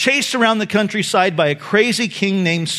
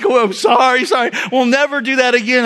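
put-on scared boys; 5 semitones higher, 3 dB louder than usual. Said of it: "they were just like".

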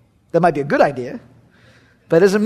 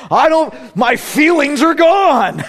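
higher, drawn-out mock distress; Ken Graves; 4 semitones higher, slower than usual. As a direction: cry out slowly in an imitated preacher's voice. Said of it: "This is Ken Graves".

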